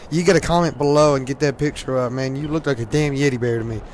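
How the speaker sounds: normal talking voice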